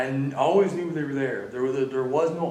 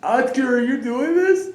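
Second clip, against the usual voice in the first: much higher and louder, alarmed; startled shout; 12 semitones higher, 11 dB louder than usual. Real, not acted. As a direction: call out to Oscar in shock. Sudden reaction to Oscar.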